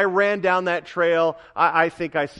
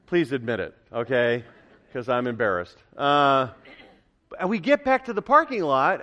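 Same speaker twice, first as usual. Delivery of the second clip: ordinary talk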